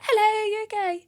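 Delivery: very high, chirpy mimicked hello; friendly greeting. Straight-faced friend once greeted, suddenly high and friendly.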